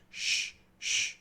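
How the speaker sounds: "sh" hissed with no voice; voiceless sh demo. Shown as a demonstration. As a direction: hiss the sh sound with no vibration. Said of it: "S, H".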